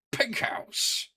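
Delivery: mocking squeak. Mocking another player.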